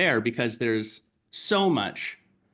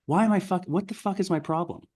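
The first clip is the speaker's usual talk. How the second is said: high, exasperated rant to a mentor; mock exasperated client; 8 semitones higher than usual. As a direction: throw up your hands at yourself. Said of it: "Carol, like".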